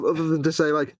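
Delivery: usual speaking voice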